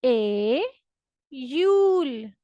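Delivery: ए then slow यूल; Russian iyul. Shown as a demonstration. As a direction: split ए and यूल apart. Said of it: "next हमारे पास है जुलाई". (ए... यूल, अलग-अलग बोलें)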